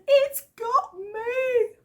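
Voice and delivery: put-on high, slow wailing child; tearful little boy wail; crying toddler voice. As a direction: imitate a sobbing toddler.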